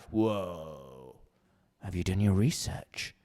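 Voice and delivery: low, soft, drawn-out warning imitation; cautioning know-it-all. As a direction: play the seasoned traveller, low and hushed. Mimics cautioning seasoned travellers.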